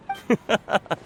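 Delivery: short laugh. Acted. Shopkeeper laughs off payment.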